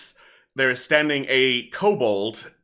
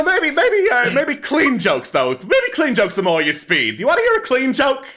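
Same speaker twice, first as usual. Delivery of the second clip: higher, livelier stage patter; kobold comedian; 13 semitones higher, 6 dB louder than usual. Acted. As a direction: work the crowd like a hammy stand-up comic. Kobold tells joke.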